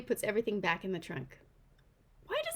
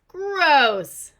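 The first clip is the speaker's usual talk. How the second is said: loud disgusted shout; yell; 6 semitones higher, 12 dB louder than usual. Acted. Driver yelling at them from his car, loud disgusted shout. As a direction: bark it out the car window in disgust. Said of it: "sticks his head out the window and yells".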